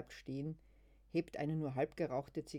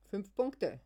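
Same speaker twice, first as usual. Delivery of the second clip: approving; 6 semitones higher, 8 dB louder than usual. Acted. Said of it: "sagt Luise anerkennend".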